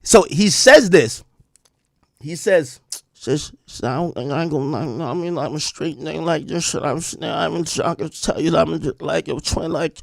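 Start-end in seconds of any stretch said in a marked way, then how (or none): 3.1-10.0 slurred mumbling imitation, words running together; mush-mouthed. Mocking the rapper explaining himself, mush-mouthed. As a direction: garble it into soft mush.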